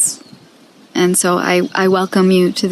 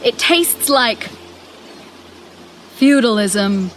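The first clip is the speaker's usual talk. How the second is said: higher, dramatic spoken-word prayer with long pauses; 5 semitones higher than usual. Acted. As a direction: plead with God in measured, theatrical phrases. Performs her prayer monologue.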